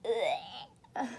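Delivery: plain reading voice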